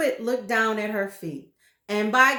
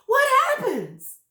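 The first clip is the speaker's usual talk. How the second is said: much higher, louder fearful question; frightened; 8 dB louder than usual. Acted. Frightened wife begging to know, high fearful cry. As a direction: ask in terror. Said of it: "gasped Missus Twit".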